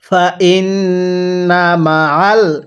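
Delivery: stretched syllables, measured Arabic reading; slow recitation. Shown as a demonstration. Teacher models the word slowly for learners to copy. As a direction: draw out ওয়া র ফা না evenly. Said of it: "তাহলে মিলাই পড়ুন।".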